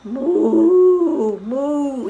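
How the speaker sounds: gentle long moo; cow moo. Shown as a demonstration. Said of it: "the cow says moo".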